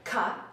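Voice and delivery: "ka" with a crisp back K; K sound. Shown as a demonstration. Coach shows the K to the class, crisp. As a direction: say "ka" from the back of the tongue. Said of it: "if you make the K".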